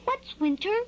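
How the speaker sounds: high, curious chick; little hatchling's curious question; great curiosity. A narrator's reading. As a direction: ask high and wide-eyed, like a baby bird. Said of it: "with great curiosity asks".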